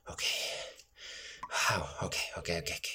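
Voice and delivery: shaken whisper. Acted. Startled, calming himself down.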